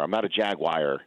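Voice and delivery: normal talking voice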